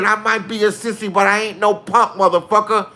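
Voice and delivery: [name] yelling at the repo men. Retold or imitated. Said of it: "He said".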